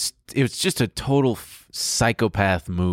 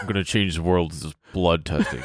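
low put-on founder voice; mock Holmes voice; 5 semitones lower than usual. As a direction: drop low to mimic the founder. Repeats line as Holmes's low voice.

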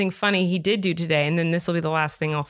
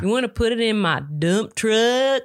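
slow southern drawl; playing a toy truck with a southern twang; Mater; 3 semitones higher than usual. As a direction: stretch DUMP TRUCK in a cartoon southern accent. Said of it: "we did that voice a lot".

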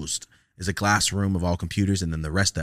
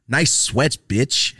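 higher, louder taunt; mocking insult; 4 semitones higher, 6 dB louder, much slower than usual. Student at the door taunting the teacher, loud mock. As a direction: fling it back over your shoulder.

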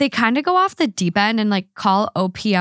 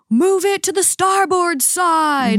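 high, slow mock captain's order; bossy sailor voice; 9 semitones higher, slower than usual. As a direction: bark it out like a bossy skipper. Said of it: "the guys are like".

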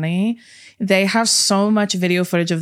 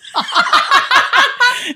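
high laugh; 4 dB louder than usual. Real, not acted. Laugh after acted quote.

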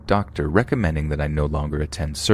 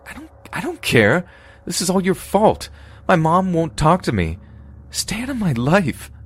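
high, raised furious voice; tearful anger; 13 semitones higher than usual. Acted. Narrator telling Winnie to stay away, furious and crying. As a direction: lash out and cut her off.

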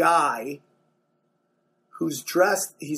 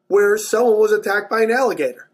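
ordinary delivery

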